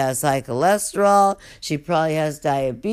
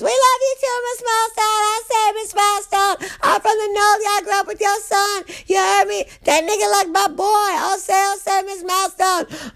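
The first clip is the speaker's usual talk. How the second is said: much higher, hammed-up inmate calling out; 3 dB louder than usual. Inmates calling to the guard, high mock shout. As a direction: squeal it out like a rowdy inmate.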